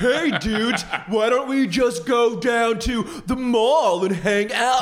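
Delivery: high, loud, drawn-out American dude; mock a Brit faking an American accent; bad American accent. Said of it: "what I imagine a bad English ac-, like, a American accent".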